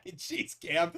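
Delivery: high laughter through the words; laughing. Real, not acted. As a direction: crack up mid-phrase. Laughing at own joke.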